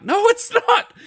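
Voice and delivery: very high, squeaky protest; exasperated denial. Retold or imitated. Uncle arguing with nephew over the real game, exasperated high voice. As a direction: contradict him with the voice shooting up.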